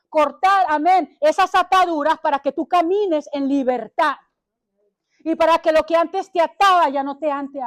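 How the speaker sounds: loud, high, fervent sermon delivery; loud fervent preacher; full-force preaching. Acted. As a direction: preach it out to the whole church.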